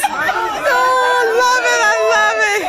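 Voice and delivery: excited squeal. Real, not acted. Gushing over reveal.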